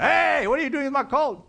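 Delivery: acted outraged challenge; mock yell. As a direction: call out in mock alarm as the colt's owner. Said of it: "who's gonna scream at me".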